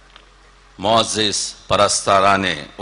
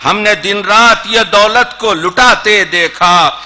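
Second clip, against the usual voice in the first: Urdu poetry recitation; 8 semitones higher, 7 dB louder than usual. Acted. Poet closes the poem on beauty for the audience, declaimed.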